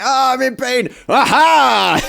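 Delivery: high, hammed-up pained villain voice; mocking the villain. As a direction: ham up a whiny villain in pain. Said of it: "he was like".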